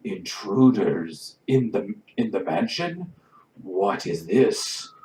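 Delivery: slow booming fire giant. Acted. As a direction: rumble it out like a giant. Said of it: "a booming voice come from the".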